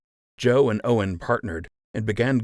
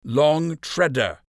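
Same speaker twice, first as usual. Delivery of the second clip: rising anger; 8 semitones higher, 3 dB louder, slower than usual. A narrator's reading. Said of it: "his own anger rising".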